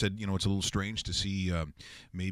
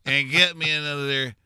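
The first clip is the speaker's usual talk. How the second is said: put-on pompous old man; 6 semitones higher, 5 dB louder than usual. Continues pompous character ordering drinks.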